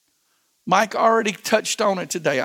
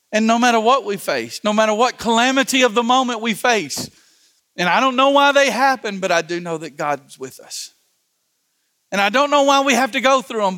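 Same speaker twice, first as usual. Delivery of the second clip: rising preaching; 3 semitones higher, 4 dB louder than usual. Acted. Pastor urging his congregation through hard times, building preacher fervor. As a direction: build the refrain like a preacher climbing to a peak.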